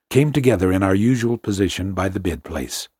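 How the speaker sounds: usual speaking voice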